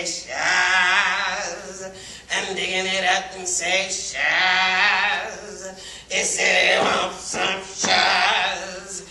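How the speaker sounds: lilting Gullah folktale telling with low chanted refrains; Gullah storytelling. A narrator's reading. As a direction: spin the tale in Gullah, half sung.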